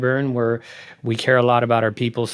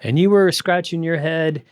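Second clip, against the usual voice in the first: ordinary talk, nothing marked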